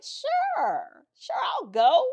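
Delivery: high, sweetly eager yes; over-willing agreement. Retold or imitated. Teen agreeing to drive for her sister, over-willing. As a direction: lift the pitch and volunteer innocently.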